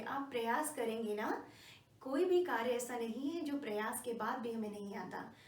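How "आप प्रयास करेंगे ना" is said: ordinary talk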